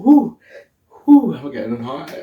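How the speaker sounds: ordinary talk, nothing marked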